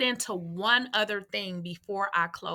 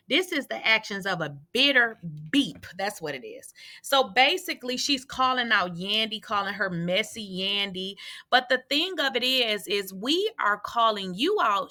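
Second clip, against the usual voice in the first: ordinary talk, nothing marked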